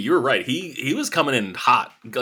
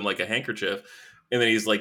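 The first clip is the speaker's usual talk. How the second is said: normal talking voice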